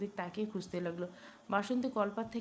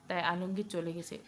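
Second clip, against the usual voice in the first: usual speaking voice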